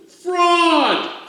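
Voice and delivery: high, loud outraged shout; angry crowd cry. Acted. Maddened crowd shouts at the umpire, loud angry cry. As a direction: hurl it like a furious crowd. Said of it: "cried the maddened thousands".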